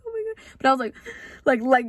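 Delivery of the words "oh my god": high, softer tearful cry; a light imitation of sobbing; remembering bawling in the car, mock tearful; retold crying. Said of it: "like, crying".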